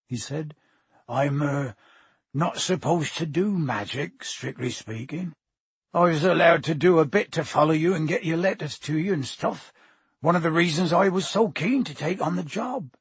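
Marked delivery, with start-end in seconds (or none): 1.1-12.9 big friendly giant, a little sheepish; Hagrid, sheepishly confiding. Acted. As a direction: admit it haltingly, then warm to the tale.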